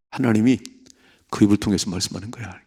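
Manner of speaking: hushed, quick whisper as David; a quick confiding whisper. Acted. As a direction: whisper it like a secret.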